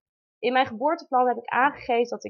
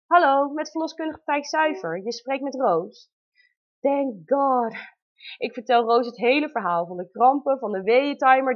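ordinary delivery

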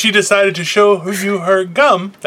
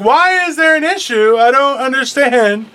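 much higher, slowed mock-clueless voice; mock confusion; 9 semitones higher, 3 dB louder than usual. Mimicking cohost's blank face, high and slow. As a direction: play her as wide-eyed and lost. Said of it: "You're like".

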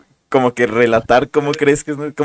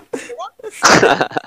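high laugh. Real, not acted. Laugh after mock quote.

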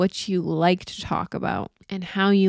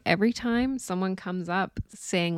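ordinary talk

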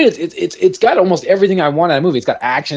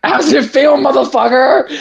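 higher, gleeful jeer with a laugh; laughing taunt; 8 semitones higher than usual. Retold or imitated. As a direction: gloat at the beaten bug, laughing. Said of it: "mocking the captured bug".

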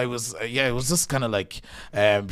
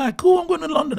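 excited; 5 dB louder, faster than usual. Retold or imitated. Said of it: "in my mind, I was like".

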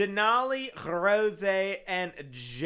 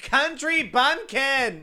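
loud, higher, drawn-out cheer; playful hollering; 7 semitones higher, 8 dB louder, much slower than usual. Pretend. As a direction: holler it slowly like a hype call. Playful hype of rapper's nickname.

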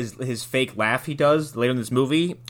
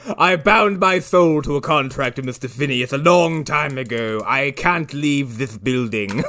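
lisping, mock-tragic bound spirit; ghostly butler. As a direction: confess a curse as a lisping ghost. Riffing as dead butler's ghost.

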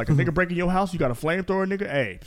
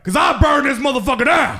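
loud, high mock threat; boastful shout; 9 semitones higher, 6 dB louder than usual. Boasting to friends about defending his home, boastful shout.

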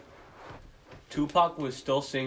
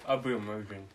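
mouth full; 3 semitones lower, 4 dB louder than usual. Real, not acted. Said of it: "I'm sorry that I'm eating".

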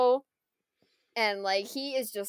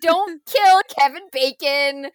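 louder, slower plea; mock pleading shout; 5 semitones higher, 9 dB louder, slower than usual. Peter begging Nebula not to shoot, a mock loud plea. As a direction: beg loudly to spare him. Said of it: "And he's like".

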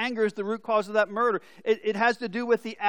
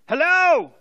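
loud, high-pitched exasperated yell; angry shout; 9 semitones higher, 11 dB louder than usual. Acted. Fed-up man answering the same nuisance call, angry shout. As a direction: bellow it into the phone. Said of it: "The angry voice shouted".

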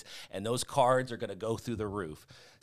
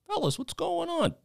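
squeaky, high mock teacher; high-pitched teacher voice. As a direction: pipe it up high like a timid teacher. Teacher voiced in retold film scene.

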